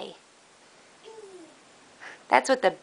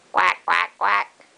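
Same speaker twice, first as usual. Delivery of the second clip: a lightly voiced, slightly lower quack quack quack; duck quacks; 3 semitones lower than usual. Parent imitating a duck for a toddler, lightly voiced quacks. Answering what a duck says.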